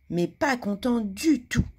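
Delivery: plain reading voice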